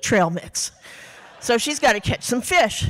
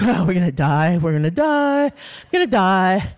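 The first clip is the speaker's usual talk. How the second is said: retold freak-out; 4 semitones lower than usual. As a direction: chant it as a hammed-up moan of doom. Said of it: "I'm starting to freak".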